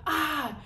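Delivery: short high-pitched scream; sudden mock scream; imitated scream. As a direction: mimic being caught by a cop. Re-enacts scream at the cop.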